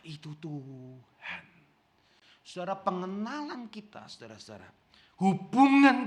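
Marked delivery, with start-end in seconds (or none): 0.1-1.4 awed hush. Retold or imitated. Yohanes recognises Jesus, awed.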